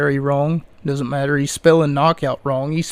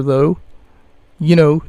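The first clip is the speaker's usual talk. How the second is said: ordinary delivery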